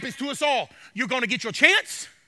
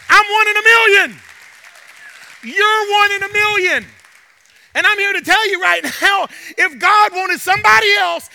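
loud, driving sermon delivery; fiery pulpit push; preaching at full force; 3 semitones higher, 7 dB louder than usual. Acted. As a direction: preach it hard to the room.

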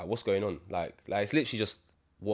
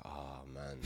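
much softer, resigned ah man; deflated sigh; 5 semitones lower, 8 dB quieter than usual. Retold or imitated. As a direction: let it sag out of you. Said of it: "I'm just sitting there like".

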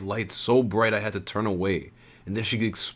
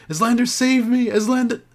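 high, loud terrified scream for help; woman drowning in silver, screaming for Eslander; desperate plea for help; 6 dB louder than usual. A narrator's reading. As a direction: beg to be saved. Trapped woman begging rescue.

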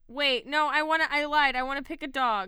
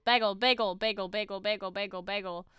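"bagel" rattled off, sinking lower and softer; rapid-fire repetition; 4 semitones lower, 5 dB quieter, faster than usual. Shown as a demonstration. Streamer repeating the word until it sounds odd, rapid-fire and fading. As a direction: run "bagel" together, fading away. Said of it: "whenever you say it really fast".

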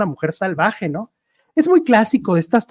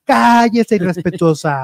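mock indignant scolding; 3 semitones higher, 4 dB louder, slower than usual. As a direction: play an offended soap-opera matriarch. Acted mother-daughter soap quarrel.

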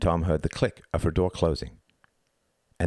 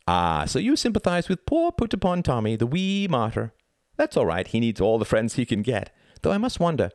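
high, mock-sympathetic child's voice; precocious boy's high, sneering lilt; taunting boy; 6 semitones higher than usual. A narrator's reading. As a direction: needle him with false sympathy.

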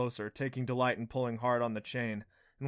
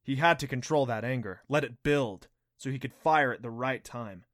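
ordinary delivery

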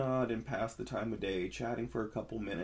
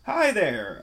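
louder call over the fence, higher; 9 semitones higher, 11 dB louder than usual. A narrator's reading. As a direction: hail the neighbour across the yard. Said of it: "I called out".

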